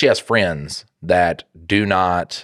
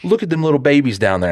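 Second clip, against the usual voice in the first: high defensive plea; 6 semitones higher than usual. Retold or imitated. Defending himself to wife.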